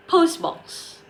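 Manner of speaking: POST in "post box" pitched high; stress on POST. Shown as a demonstration. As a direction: lean on the first word of "post box", drop on box. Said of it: "place the stress on the first noun".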